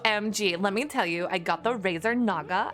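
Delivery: put-on Valley Girl. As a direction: talk like a gushing Valley Girl. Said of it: "thanks for the Valley Girl redeem".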